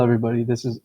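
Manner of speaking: ordinary talk, nothing marked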